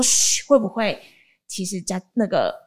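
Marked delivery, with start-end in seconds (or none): 0.0-0.4 voiceless "shh", retold; hushing shush. Host re-enacting a shush inside the elevator, hushing shush. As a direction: imitate a parent's hushing. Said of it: "就是在电梯里面说".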